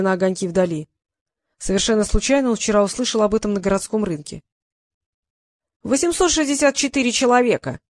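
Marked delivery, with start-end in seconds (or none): 5.8-7.8 high, forceful figure; mildly barked exclamation; barked answer. A narrator's reading. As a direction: snap the answer out. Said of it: "рявкнул дракон".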